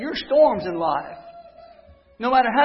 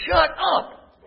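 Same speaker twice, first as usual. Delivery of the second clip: mock retort; 7 semitones higher than usual. Repeats brother's retort.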